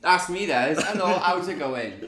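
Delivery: big, slow, loud hello; loud drawn-out greeting. Real, not acted. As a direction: belt the hello out slowly. Guest greets loudly, drawn out.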